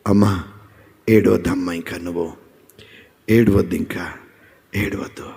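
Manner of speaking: తగ్గిన స్వరంలో మెల్లని ఓదార్పు; నెమ్మదిగా, మృదువుగా, ఆఖరికి దాదాపు గుసగుసగా; tender comforting Lord. Acted. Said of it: "దేవుడు అంటున్న మాట". (ప్రభువు బాధలో ఉన్నవారిని మృదువుగా ఓదారుస్తూ)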